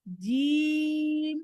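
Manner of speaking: জিম held very long; a slow, held-out জিম; long jeem. Shown as a demonstration. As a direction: stretch জিম slowly to the end. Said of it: "এরকম practice করবে".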